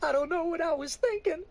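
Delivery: high, loud crying woman's voice; sobbing. Acted. Woman sobbing to the preacher, high and loud. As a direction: sob the words out. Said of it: "she sobs".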